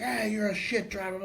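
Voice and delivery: high-pitched imitation of an irate rider; retold road-rage gripe; mock angry heckle. Retelling angry scooter rider's shout.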